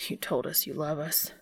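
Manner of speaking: ordinary delivery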